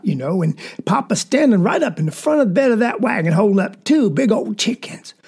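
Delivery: usual speaking voice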